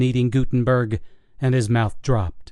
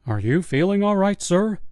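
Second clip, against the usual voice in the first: higher, patient, condescending question; lightly voiced investigator; 7 semitones higher than usual. Investigator humoring the frantic old guard, lightly condescending. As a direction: talk him down like he's confused.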